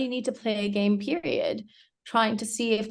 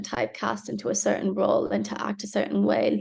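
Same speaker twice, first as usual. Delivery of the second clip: normal talking voice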